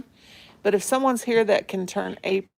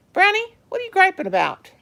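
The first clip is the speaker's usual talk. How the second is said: much higher, louder mock scolding of the dog; pet talk; 7 semitones higher, 3 dB louder than usual. Real, not acted. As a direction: tease the grumpy dog in a high sing-song. Owner teasing her griping dog.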